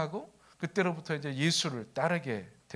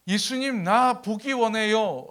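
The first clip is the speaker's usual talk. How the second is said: high, slow imitated pleading; earnest plea; 6 semitones higher, 6 dB louder, slower than usual. Blind man asking Jesus for sight, earnest plea. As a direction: ask with eager longing, voice raised. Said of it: "낳고자 하는 그 간절함".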